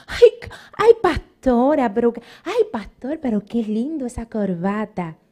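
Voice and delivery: syrupy fake praise, hammed up; mock flatterer. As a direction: mimic a fawning church member cooing over a tie. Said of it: "No confíen en gente que viven".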